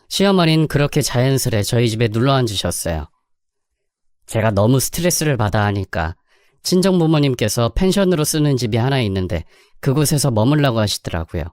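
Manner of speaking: usual speaking voice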